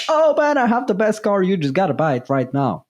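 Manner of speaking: hammed-up car salesman in a dubious accent; pushy car salesman. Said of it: "I don't even know what that accent was".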